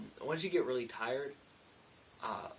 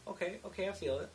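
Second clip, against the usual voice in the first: higher, light coworker voice; raised-pitch quote of her; imitating Amber; 7 semitones higher, faster than usual. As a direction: lift the pitch to mimic her. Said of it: "she was like".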